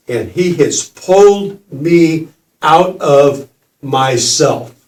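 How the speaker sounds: slow, drawn out, building suspense; slow dramatic telling. Real, not acted. Telling the audience about the kid, slow and dramatic. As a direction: hold each word to build the moment.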